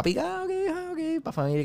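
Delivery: high soft mock-cheery aside. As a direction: squeak it out like a silly cheerful ad. Mocking the movie's family scene.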